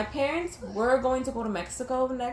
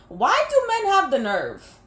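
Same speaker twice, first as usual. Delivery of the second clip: exasperated; 5 semitones higher, 6 dB louder, slower than usual. Real, not acted.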